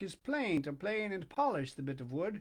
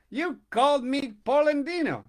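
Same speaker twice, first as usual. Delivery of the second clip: loud, raised, accusing; angry accusation; 7 semitones higher, 8 dB louder than usual. Acted. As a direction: throw the nickname back at him. Quarrel between two old men.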